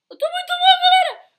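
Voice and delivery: high, loud frustrated whine. Real, not acted. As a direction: whine about playing badly. Frustrado por errar. (lamento agudo)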